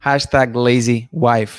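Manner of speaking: ordinary talk, nothing marked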